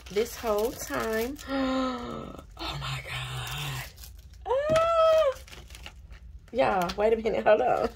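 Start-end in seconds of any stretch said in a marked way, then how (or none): 1.5-5.3 gasp, then squeal it out; excited gasp. Real, not acted. Vlogger opening a gift, squealing with delight. Opening Happy Mail, gasps.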